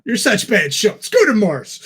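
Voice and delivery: normal talking voice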